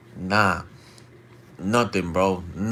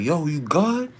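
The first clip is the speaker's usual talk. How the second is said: higher-pitched call, mimicked; raised pitch, retold shout; 7 semitones higher than usual. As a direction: call over to check on a stranger. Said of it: "We all shouting like".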